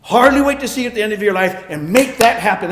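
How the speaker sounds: God caricatured as gleeful, loud and fast; hammed-up gleeful threat; preacher mocking a false picture of God, gleeful and quick; mock eager punisher. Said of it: "he doesn't sit there and go".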